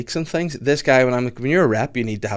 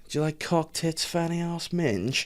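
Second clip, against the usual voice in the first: higher, softer, memorized-list sales pitch; imitating the rep; 6 semitones higher, 6 dB quieter, slower than usual. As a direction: rattle it off like a rehearsed line. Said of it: "then he goes".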